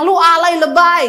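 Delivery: mock her loudly with a laugh; teasing loud retort. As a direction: pastor teasing a complaining church member, loud and laughing. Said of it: "Saya bilang".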